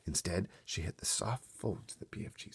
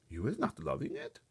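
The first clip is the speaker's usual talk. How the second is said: mock innocent, a touch higher; innocent question; 9 semitones higher than usual. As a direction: ask as if you meant no harm. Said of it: "the B F G asked innocently".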